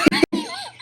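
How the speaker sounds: a short, high giggle. Real, not acted. Co-host giggling at his own polite comeback, short high giggle.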